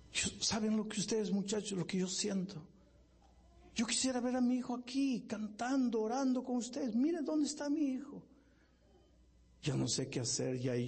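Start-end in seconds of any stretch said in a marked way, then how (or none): 0.1-8.2 weeping mother's plea. Retold or imitated. Grieving mother begging the youth for prayer, high anguished cry. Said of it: "Y la mujer llorando dice".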